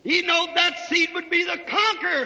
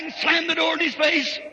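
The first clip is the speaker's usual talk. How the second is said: normal talking voice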